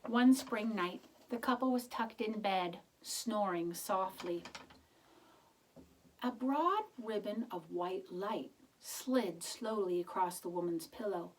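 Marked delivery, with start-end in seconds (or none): none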